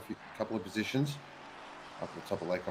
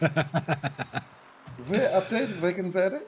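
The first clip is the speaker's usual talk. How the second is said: higher, hammed-up Dutch or Flemish accent; dodgy accent; 10 semitones higher, 3 dB louder, much slower than usual. Co-commentator answers a request for Flemish or Dutch, dodgy accent. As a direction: fake a Dutch weather update, badly. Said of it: "That's a very dodgy accent".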